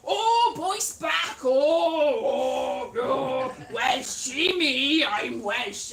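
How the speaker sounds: loud, drawn-out imitation of gushing; mocking over-the-top fuss; mock excited crowing. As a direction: crow it slowly, hammed up. Mimicking people's excited Welsh reaction.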